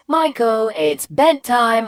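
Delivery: high call, "bedtime" drawn out slowly; calling mum. Retold or imitated. Mum telling Michael it's bedtime, insistent calling. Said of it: "or if she said".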